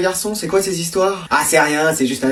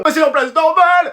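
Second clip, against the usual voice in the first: high-pitched mimicry of the reply; mocking echo; 7 semitones higher than usual. Teacher throwing pupil's excuse back, mocking echo.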